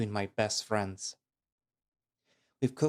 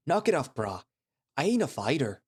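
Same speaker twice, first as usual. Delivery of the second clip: laid-back gator voice; 4 semitones higher, 4 dB louder than usual. Acted. Gator Liam voiced in story.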